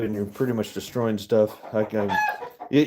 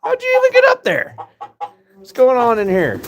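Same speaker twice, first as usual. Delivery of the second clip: exasperated yell; 10 dB louder than usual. Real, not acted. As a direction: holler in disbelief at the birds. Yelling at chickens.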